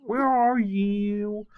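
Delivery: high, slow, whiny nasal singing. Retold or imitated. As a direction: stretch it into a nasal whine. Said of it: "So nasally".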